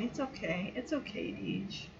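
hushed, low-pitched reassurance; retold soothing comfort. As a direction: imitate him murmuring softly to soothe her. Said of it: "he rubs her back".

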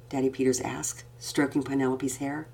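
ordinary delivery

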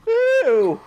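an offended British queen; Queen's disgust. Acted. Host, still as the Queen, objects in a very high posh voice.

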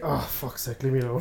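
understated weary grumble; grumpy. Acted. Friend voicing a grumpy game hero, mild grumpy mutter. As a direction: grumble it under your breath. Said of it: "more realistic grumpy".

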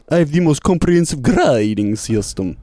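mock Transylvanian vampire; Transylvanian accent. Shown as a demonstration. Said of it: "like a Transylvanian".

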